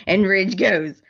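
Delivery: ordinary talk, nothing marked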